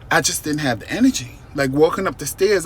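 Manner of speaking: usual speaking voice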